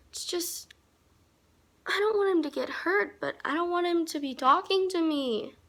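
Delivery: high, whining plea; high-pitched whiny complaint. Real, not acted. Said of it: "I sound like such a whiny baby".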